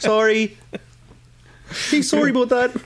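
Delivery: high-pitched Canadian apology; mock Canadian thief. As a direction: put on a sheepish Canadian accent. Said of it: "That's very Canadian thief".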